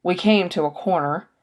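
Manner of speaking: plain reading voice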